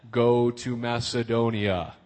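low, slow God voice; big booming voice from heaven. Acted. God directing Paul to travel, in a booming movie voice. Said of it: "in a big booming voice from heaven".

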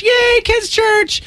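high, slow mock cheer. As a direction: cheer it up like a kid. Mock cheer for kids' church.

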